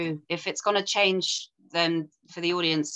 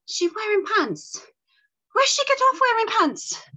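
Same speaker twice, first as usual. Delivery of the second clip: much higher, scandalised exclamation; shocked; 5 dB louder than usual. Acted. Reader voicing Agnes, shocked at the woman in pants. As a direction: squeal it in disbelief.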